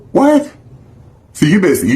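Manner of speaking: normal talking voice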